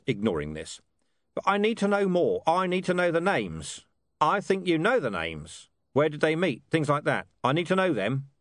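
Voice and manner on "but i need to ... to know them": higher-pitched, pressing interrogator; insistent police commander. Acted. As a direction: press hard for the names.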